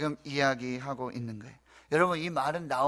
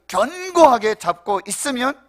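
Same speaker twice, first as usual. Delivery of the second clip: high, forceful 견고하게, stretched for emphasis; emphatic repeat; 8 semitones higher, 9 dB louder than usual. Real, not acted. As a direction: lean hard and long on 견고하게. Preacher repeats verse emphatically.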